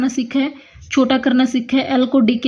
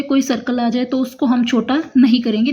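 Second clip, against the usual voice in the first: ordinary delivery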